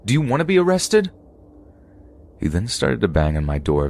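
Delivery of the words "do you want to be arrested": yelling. Retold or imitated. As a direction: shout the warning through the door. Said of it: "I yelled one more time".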